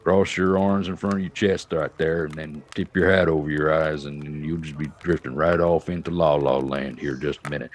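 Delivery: a light low Texan drawl; a hint of an unhurried Texan cowboy; Sam Elliott drawl. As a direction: lull the listener to sleep like a laid-back old cowboy. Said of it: "be like, "Sam Elliott".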